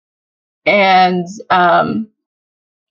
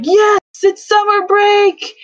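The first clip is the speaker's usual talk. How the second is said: much higher, slow, cheering students; whole class cheering that summer break has begun; 12 semitones higher than usual. Retold or imitated. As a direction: shout it out like kids freed for summer.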